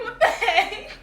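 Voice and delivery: high-pitched, incredulous retold reaction; baffled outburst. Retells baffled reaction on bus.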